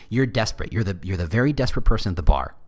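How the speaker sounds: normal talking voice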